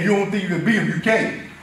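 usual speaking voice

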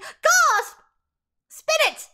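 high, loud hammed-up exclamation; mock shocked cry. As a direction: call out in pretend shock. Acted shock, then wheel order.

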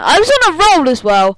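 high, loud protest; exasperated complaint. Real, not acted. Game master whose reading got interrupted, loud exasperation. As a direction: wail loudly at being thrown off your flow.

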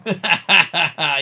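loud burst of laughter. Real, not acted. Streamer laughing at his own character's name, loud laugh. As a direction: crack up out loud.

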